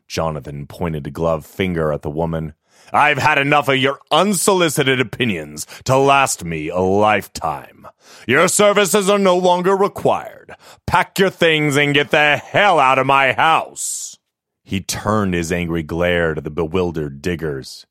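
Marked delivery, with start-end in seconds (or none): 2.9-14.2 loud, higher, seething tirade; furious dismissal. Acted. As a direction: throw her out of the house in a rage. Master fires the maid.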